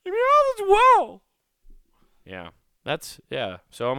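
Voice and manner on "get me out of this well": very high, loud squeal of panic; desperate shout. Retold or imitated. Trapped in the well begging his family, high loud squeal. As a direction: screech for help from the bottom of the well. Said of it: "I'm like".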